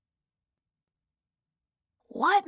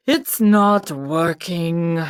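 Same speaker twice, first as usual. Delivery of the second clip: sighing king; 4 semitones lower, slower than usual. Acted. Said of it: "sighed the king".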